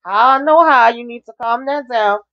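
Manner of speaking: high, put-on voice telling Franklin off; scolding quote. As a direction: squeak it out like a scolding auntie. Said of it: "I said".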